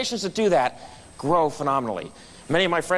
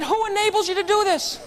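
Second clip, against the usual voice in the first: curious customer; 14 semitones higher than usual. Retold or imitated. Customer asks Chip a question.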